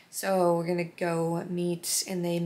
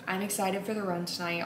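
ordinary delivery

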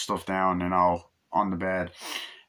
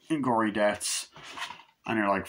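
normal talking voice

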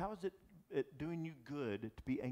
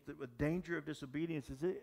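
normal talking voice

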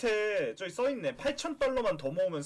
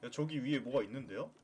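normal talking voice

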